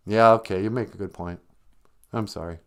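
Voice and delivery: usual speaking voice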